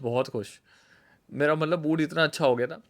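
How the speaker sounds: normal talking voice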